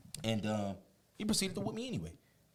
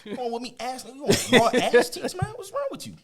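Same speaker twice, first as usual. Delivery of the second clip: mock outraged yell; 12 semitones higher, 9 dB louder than usual. Mock yelling at mom about stripping.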